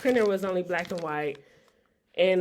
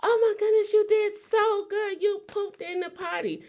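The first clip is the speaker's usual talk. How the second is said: potty training praise; 14 semitones higher than usual. Retold or imitated. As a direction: cheer like your toddler just did it. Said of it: "I would make, like, a big thing".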